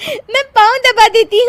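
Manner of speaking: trying to talk through giggles; laughing daughter-in-law; giggly. Acted. Laughing bahu.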